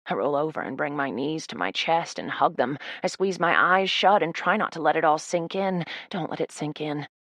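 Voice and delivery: ordinary delivery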